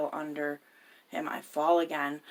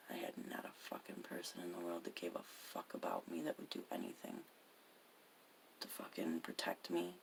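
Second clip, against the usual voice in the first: whispered, much softer; barely voicing it, as if to herself; real sad whisper, much softer; hushed confession; 10 semitones lower, 12 dB quieter than usual. Real, not acted. Confessing loneliness, voice drops away.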